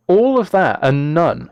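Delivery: ordinary talk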